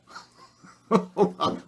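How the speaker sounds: short high burst of embarrassed laughter; caught-out laugh at the phone ringing. Real, not acted. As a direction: laugh it off at the interruption. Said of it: "I just got a phone call".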